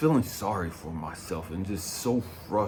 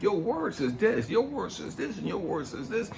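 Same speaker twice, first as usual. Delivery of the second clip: high-pitched, repetitive retold pleading; quoting back insistently; 9 semitones higher than usual. Man reading God's words back to him, insistent. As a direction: hammer each repeat in a raised pitch. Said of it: "I'm quoting God's words back to him".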